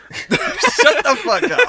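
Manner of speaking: high, slow, laughing yell; laughing shout. Real, not acted. Friends teasing, laughing protest.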